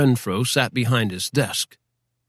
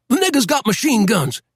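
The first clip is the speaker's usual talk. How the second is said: panicked yell; 11 semitones higher than usual. Acted. Fleeing rider yelling in panic.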